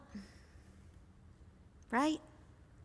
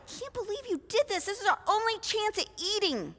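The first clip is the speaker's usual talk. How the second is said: exasperated brothers; 7 semitones higher, 5 dB quieter, faster than usual. Acted. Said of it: "your brothers are like".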